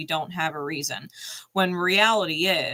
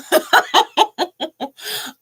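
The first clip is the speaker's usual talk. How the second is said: laughter; 11 semitones higher, 7 dB louder than usual. Real, not acted. Laughing at own story.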